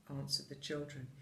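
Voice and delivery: plain reading voice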